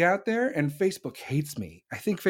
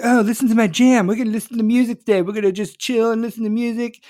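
loud, higher, fast mock chill voice; mock chill podcaster; 5 semitones higher, 6 dB louder than usual. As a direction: parody a lazy music-show host. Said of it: "They're like".

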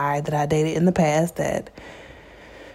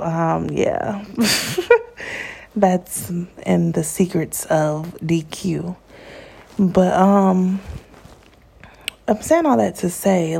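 normal talking voice

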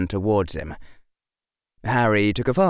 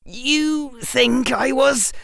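slow, high and halting; faltering in raised-pitch disbelief; trouble saying it; slower than usual. A narrator's reading. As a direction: struggle to get the words out. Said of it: "was having trouble saying it".

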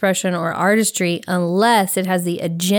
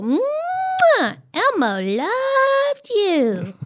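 high, slow squeaky toy doll; 14 semitones higher, much slower than usual. Retold or imitated. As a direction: chirp it like a talking Elmo toy. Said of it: "it would go".

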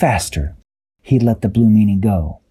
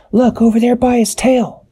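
high character voice; Dashi's voice. A narrator's reading. Dashi to crew, high pointing call. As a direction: lift into a high squeaky kid voice. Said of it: "Dashi said".

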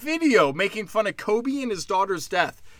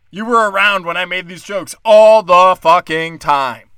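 louder, insistent rant; exasperated rant; 10 dB louder than usual. Real, not acted. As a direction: hammer it home at the friends. Ranting at friends.

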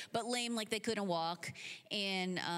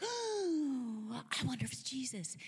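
high drawn-out "oh", then a quick hopeful guess; eager wonder; 4 semitones higher than usual. Acted. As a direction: wonder aloud with eager hope. Martha hears Jesus arriving.

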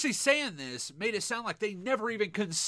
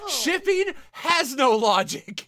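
louder, slow and emphatic; exasperated insistence; 5 semitones higher, 6 dB louder, slower than usual. Real, not acted. To Fran, insisting slowly and loudly. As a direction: spell it out to win the argument. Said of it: "arguing about shipping".

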